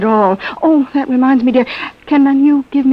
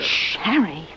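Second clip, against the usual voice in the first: librarian shushing whisper; 5 semitones lower, 8 dB quieter, slower than usual. Acted. Wife hushing husband, guest nearby.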